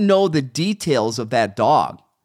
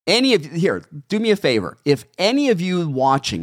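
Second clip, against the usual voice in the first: ordinary delivery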